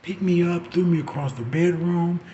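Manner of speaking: ordinary talk, nothing marked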